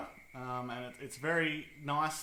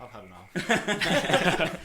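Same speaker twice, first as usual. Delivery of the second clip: laughing mock surrender; 3 semitones higher, 10 dB louder, much slower than usual.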